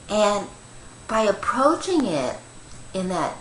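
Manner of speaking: ordinary delivery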